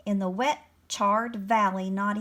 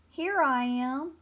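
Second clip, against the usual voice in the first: slow, higher, mildly shy reply; 4 semitones higher than usual. A narrator's reading. Dragon answers the animals, shy. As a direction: answer hesitantly, drawing it out. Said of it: "Hector replied shyly".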